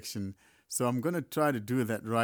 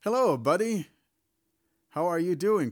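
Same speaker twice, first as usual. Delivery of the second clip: loud, high, careful dictation; dictating loudly; 6 semitones higher, 6 dB louder than usual. Real, not acted. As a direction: speak up clearly for the phone. Dictating a text to the phone.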